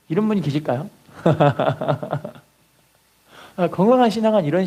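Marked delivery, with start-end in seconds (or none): 1.2-2.3 amused staccato chuckle; laugh. Real, not acted. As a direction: laugh at your own act. Laughs after the acted prayer.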